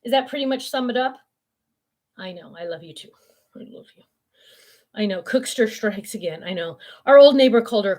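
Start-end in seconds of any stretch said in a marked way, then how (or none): 3.6-4.0 whisper. Real, not acted. Cooing to her dog.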